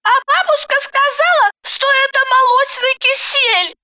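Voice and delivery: put-on very high, squeaky child voice; little boy. A narrator's reading. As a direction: pipe up like a small kid. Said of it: "рассмеялся Санька". (тоненький детский голосок)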